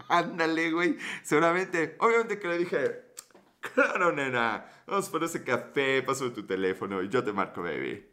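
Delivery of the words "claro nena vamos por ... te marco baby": a lounge lover crooning to his date; smooth talker. Retold or imitated. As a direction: play it cool and seductive. Suave reply to flirting woman.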